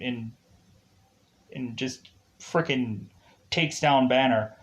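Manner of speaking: normal talking voice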